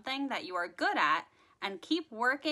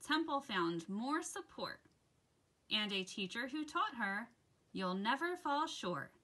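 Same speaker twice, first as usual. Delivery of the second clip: put-on gentle rhyming read-aloud for children; soft, sing-song picture book reading; story narration; 4 dB quieter, slower than usual. A narrator's reading. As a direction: read it like bedtime story time.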